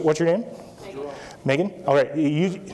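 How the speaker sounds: ordinary delivery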